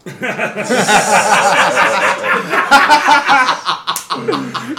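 high, prolonged burst of laughter; real uncontrolled cackling; long loud laugh. Real, not acted. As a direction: lose it laughing. Cracks up as bit ends.

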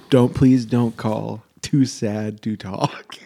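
slow, drawn-out mock sadness; fake sad plea. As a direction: play the heartbroken husband, slowly. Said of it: "I'd say".

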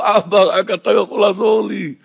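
loud, high plea for help; helpless cry. Acted. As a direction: beg a father loudly, with nothing else left. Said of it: "את הצעקה הזאת". (זעקת חוסר אונים בקול רם)

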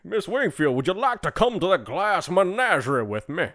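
put-on court a lady like an old Southern colonel; Southern gentleman. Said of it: "I was trying to not be Foghorn anymore".

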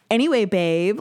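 playful, exaggerated American accent; put-on American accent. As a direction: mimic a Brit switching into American. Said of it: "switching into an American accent".

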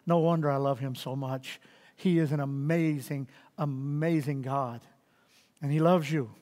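preacher quietly adoring God; tender praise. Real, not acted. Tender praise at story's end.

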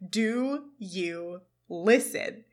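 each word stretched very slowly; slow, drawn-out repeat. Retold or imitated. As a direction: drag it out to make the point. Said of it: "And Turner again says".

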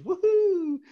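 high excited whoop. Real, not acted. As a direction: whoop with delight. Celebrating streaming stats.

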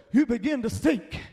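ordinary talk, nothing marked

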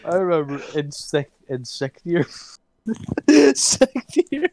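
high, loud voice breaking into laughter; high-pitched, laughter-choked storytelling; cracking up. Real, not acted. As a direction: try telling it while helpless with laughter. Cracking up while telling school memory.

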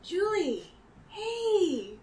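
high, bright joyful hello; light up seeing her again; happy greeting. Acted. Player greets girl warmly.

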